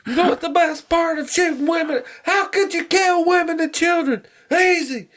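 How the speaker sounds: very high-pitched hammed-up soldier; movie soldier. Quoting Full Metal Jacket, acted soldier voice. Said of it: "fucking Full Metal Jacket".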